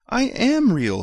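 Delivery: very high pleading insistence; little rabbit insisting. A narrator's reading. Toy rabbit insisting to the wild rabbit, high and pleading. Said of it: "said the little rabbit".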